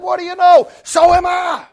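overjoyed, hammed up; delighted surprise. Man voiced, delighted.

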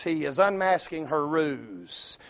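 ordinary talk, nothing marked

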